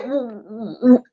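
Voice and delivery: soft, halting stammer, voice tucked back; quiet stunned stutter. Retold or imitated. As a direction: stammer it out under the breath in shock. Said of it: "he was stuttering".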